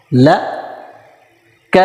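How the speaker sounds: each letter sounded apart, ل then ک; letters read separately. Shown as a demonstration. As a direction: sound ل and ک one by one. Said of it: "ان کو الگ الگ پڑھتے ہیں".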